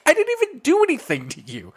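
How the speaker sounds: very high whiny protest; wounded hairdresser. Retold or imitated. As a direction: protest like hurt feelings. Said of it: "she was like".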